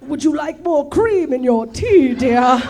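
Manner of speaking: mock regal English accent; King Charles. King Charles offering Camilla tea, mock English accent. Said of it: "this is how King Charles".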